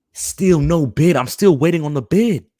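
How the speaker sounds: loud, slow frustrated yell; fed-up fan yelling about the bid; angry shout. Real, not acted. Fan ranting about missing bid.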